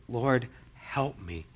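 soft, slow, low begging; humble plea. Retold or imitated. Woman kneeling before Jesus, humble plea. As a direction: plead softly, drawing the words out.

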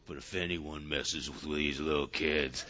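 deep, heavy, quiet Jesus warning; voice dropped an octave. Retold or imitated. Jesus warning those who harm the little ones, in a deep, heavy voice. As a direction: drop way down low and ominous. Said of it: "his voice dropped an octave".

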